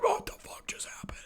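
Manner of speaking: hushed whisper of shock; whispered disbelief. Retold or imitated. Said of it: "I'm like".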